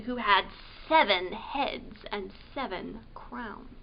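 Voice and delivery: ordinary delivery, nothing marked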